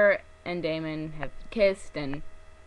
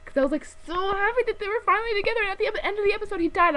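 worked-up outrage; 5 dB louder, faster than usual. Real, not acted. Upset recounting the death.

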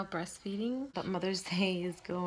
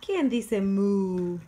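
louder, slow, stretched question; reading to baby; 11 dB louder, much slower than usual. Shown as a demonstration. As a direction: ask it bigger and slower. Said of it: "reading to baby".

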